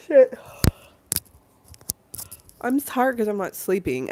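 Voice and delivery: normal talking voice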